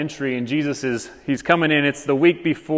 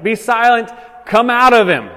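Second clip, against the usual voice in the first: loud, higher order to a demon; raised-voice exorcising command; commanding rebuke; 6 semitones higher, 5 dB louder than usual. Acted. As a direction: command it out forcefully. Acts Jesus commanding the demon.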